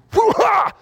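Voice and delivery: high mock judo shout; martial-arts cry. Shown as a demonstration. Preacher showing a judo flip, martial-arts cry. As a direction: let out a sudden high judo yell. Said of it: "show you what it looks like".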